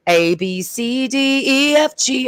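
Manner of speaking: mock him droning letters at me; mock alphabet sing-song. Mocking the date reciting the alphabet, high sing-song.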